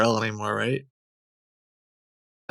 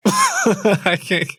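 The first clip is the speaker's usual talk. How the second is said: laughing; 9 semitones higher than usual. Real, not acted. Laughs while admitting failure.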